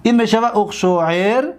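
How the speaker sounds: ordinary talk, nothing marked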